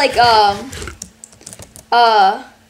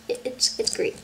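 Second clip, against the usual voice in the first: whisper; 10 dB quieter than usual. Real, not acted. Whispered aside.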